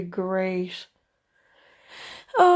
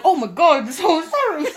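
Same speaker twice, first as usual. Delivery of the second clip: mock apology; 8 semitones higher, 6 dB louder than usual. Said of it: "I'm like".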